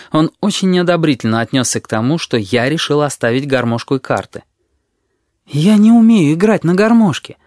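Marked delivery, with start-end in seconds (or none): 5.5-7.4 higher, louder protest; pleading to make a point; insistently explaining. A narrator's reading. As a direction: explain it emphatically, pitched up. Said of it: "втолковывал я ему".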